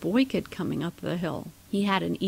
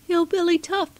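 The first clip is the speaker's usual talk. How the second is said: high, louder little squeak; squeaky; 11 semitones higher, 6 dB louder than usual. A narrator's reading. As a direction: squeak it out like a tiny kid. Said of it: "squeaked the smallest kid".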